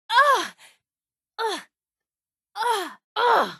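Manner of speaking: high, sharp cries of pain; pained grunts. Acted. Fighter taking hits in combat, pained high grunts. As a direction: grunt as each blow lands.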